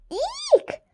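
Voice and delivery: high shrill eek; squeal. A narrator's reading. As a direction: squeal in disgust. Reacting to women news.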